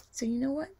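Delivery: ordinary talk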